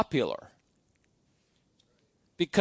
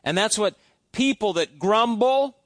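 ordinary talk, nothing marked

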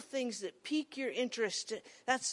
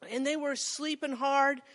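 ordinary talk

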